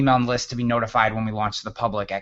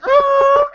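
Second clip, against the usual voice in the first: high, loud held imitated noise; a long high "ah" for a sudden stop; imitated music stop; 7 dB louder than usual. Storyteller mimics the diner's music stopping, high held noise. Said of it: "it was like the music stopped".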